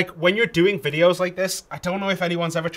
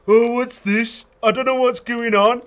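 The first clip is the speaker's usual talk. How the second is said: higher, louder Dudley accent; Dudley home accent; 8 semitones higher, 5 dB louder than usual. Shown as a demonstration. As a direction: put on a lilting Dudley voice. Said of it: "That's my home accent".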